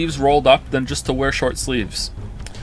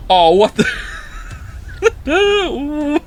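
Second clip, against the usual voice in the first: laughing; 11 semitones higher, much slower than usual. Real, not acted. Reacting to fan art.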